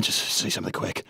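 urgent whisper to himself; whispered self-urging. Acted. Shy fan pushing himself to speak, whispered. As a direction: hiss it under your breath.

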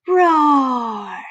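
loud, long roar sliding down; group roar, playful. A narrator's reading. Everybody roaring along with Froggy, playful roar. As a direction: let out a big drawn-out pretend roar. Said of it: "everybody roared with him".

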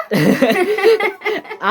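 high-pitched burst of laughter; real high giggling laugh. Real, not acted. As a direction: laugh off mother's threat. Laughs at mother's threat.

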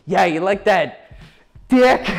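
high, loud awkward delivery with a halting pause; hesitate, then blurt the last word too high; mock-nervous fumbled line; embarrassed stumble. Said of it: "embarrass yourself and you're gonna, like, stutter".

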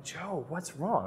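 soft, slow, caring question; gentle concern. Retold or imitated. Father comforting crying son.

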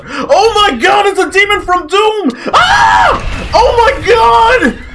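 high, loud scream of terror; panicked screaming. Real, not acted. Player spotting a demon from Doom, panicked scream. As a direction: shriek in alarm, drawing words out.

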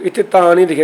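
usual speaking voice

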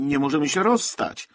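loud, high-pitched protest from a mother. A narrator's reading. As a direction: cry out in objection. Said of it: "zaprotestowała Galicowa".

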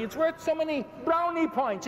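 normal talking voice

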